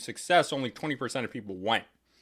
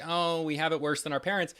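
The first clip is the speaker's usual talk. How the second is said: mocking, slightly higher whine; whiny mock complaint; 5 semitones higher, 3 dB quieter than usual. Mocking left-wing complainers about their parents, whiny mock voice. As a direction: parody a whiny complainer, softly. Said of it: "from a whiny left wing".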